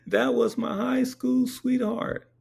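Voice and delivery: high, slow mock wail; hammed-up tearful high voice; imitated crying. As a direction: draw it out like a bawling husband. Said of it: "He's crying like that".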